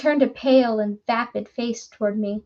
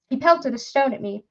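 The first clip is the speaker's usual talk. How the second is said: plain reading voice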